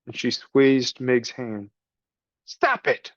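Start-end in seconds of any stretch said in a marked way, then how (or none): 2.4-3.1 higher rat shout; shouted stop. Acted. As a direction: snap to break them apart. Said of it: "shouted Roscoe".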